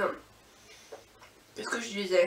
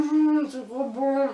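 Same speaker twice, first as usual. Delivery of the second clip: savoring; 4 semitones higher, slower than usual. Real, not acted. Savoure le pain d'épices.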